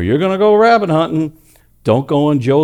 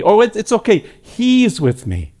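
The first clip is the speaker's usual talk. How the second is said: high then slowing into tender reassurance; Jesus vouching for a sinner, tender and slowing; reassuring Jesus voice; 7 semitones higher than usual. Acted. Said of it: "he'll say".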